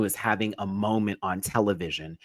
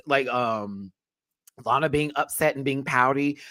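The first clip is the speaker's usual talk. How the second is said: ordinary delivery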